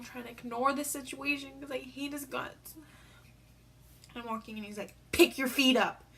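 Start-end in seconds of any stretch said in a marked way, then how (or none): 5.1-6.0 louder, bossy brother command; mock bossy order. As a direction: bark it like an annoyed big brother. Brother bossing her while walking.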